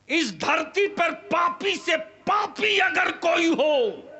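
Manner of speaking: high, slow, shouted preaching; impassioned shouting sermon. Acted. Preacher shouting at fathers who sell their sons, impassioned sermon. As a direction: thunder it at the crowd in outrage.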